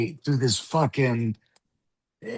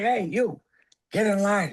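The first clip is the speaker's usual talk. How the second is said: hint of an old-Hollywood leading man; terrible Jimmy Stewart impression; 8 semitones higher than usual. Imitating the actor bossing everyone at the table in a hint of Jimmy Stewart. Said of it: "Jimmy Stewart impersonation".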